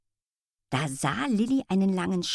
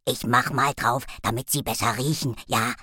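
lower, offhand little dog; cheeky offhand dog; casual dog voice; 6 semitones lower than usual. A narrator's reading.

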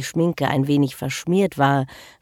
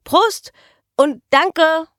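high, loud cheers over the din; shouted toast; 13 semitones higher, 5 dB louder, slower than usual. Acted. Girl clinking glasses with the man, shouting. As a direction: call out a cheery thanks.

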